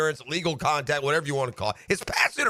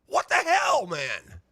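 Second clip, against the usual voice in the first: outraged shout; 2 semitones higher, slower than usual. Real, not acted. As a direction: blurt it in disbelief. Outraged at missed call.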